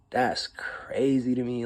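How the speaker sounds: slow whisper, stunned; whispered disbelief. Real, not acted. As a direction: breathe it out in disbelief.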